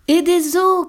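high, loud yelp of an idea; dog's cry. A narrator's reading. The dog shouting out his ingredient, loud. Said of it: "cria le chien".

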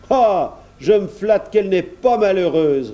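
reply proudly as the farmer; hearty countryman. A narrator's reading.

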